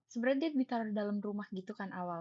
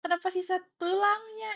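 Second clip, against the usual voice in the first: high, loud wailing questions; mock-tearful, pitched-up protest; retold tearful dismay; 9 semitones higher, 3 dB louder than usual. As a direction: wail in disbelief, acting out the upset. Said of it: "Itu aku nangis karena".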